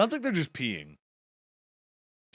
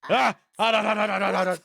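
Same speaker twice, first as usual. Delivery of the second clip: loud, high yelps of pain from scalding water; yelp at hot water; 8 semitones higher, 9 dB louder than usual. Acted. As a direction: squeal as the hot water bites.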